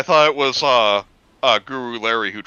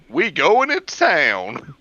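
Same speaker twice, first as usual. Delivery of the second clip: mock character voice; 6 semitones higher, 4 dB louder, much slower than usual. Voicing the character's line.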